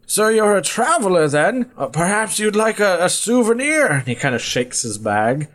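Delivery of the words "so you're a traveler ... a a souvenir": high, coaxing character voice; old peddler. Acted. Old man offers a traveler a souvenir, high coaxing voice.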